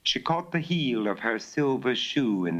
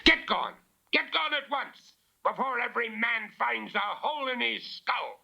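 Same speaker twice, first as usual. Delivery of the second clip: thundering shout. Acted. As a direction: thunder it down at the intruders. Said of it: "Bella heard George Alderson thunder".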